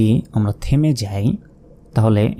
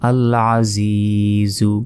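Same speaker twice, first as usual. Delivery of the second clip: slow, drawn-out Quranic chant of the word; slow recitation; much slower than usual. Shown as a demonstration. Teacher shows students the recited word, slow and drawn out. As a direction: stretch the long vowel like a reciter. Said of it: "এক second টান হবে।".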